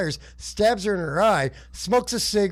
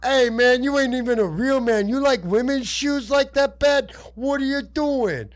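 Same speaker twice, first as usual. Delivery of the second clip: higher-pitched comic character voice; mock-taunt in a raised, hammed-up voice; Dice Man heckling; 7 semitones higher, 3 dB louder than usual. Dice Man mocking Glatman over women's shoes, higher and hammed-up. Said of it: "He's like".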